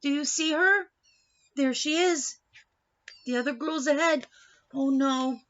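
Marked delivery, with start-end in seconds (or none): none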